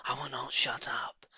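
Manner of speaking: hissed defiant rat; mild mock shriek, whispered. A narrator's reading. Rat refusing to be quiet. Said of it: "shrieked Rat".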